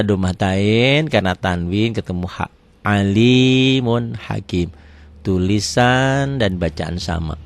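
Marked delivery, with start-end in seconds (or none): 2.8-4.7 drawn-out, chanted Arabic reading; measured tajwid recitation; slow Quran recitation. Shown as a demonstration. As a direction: recite it slowly, stretching each vowel. Teacher recites Quranic phrase.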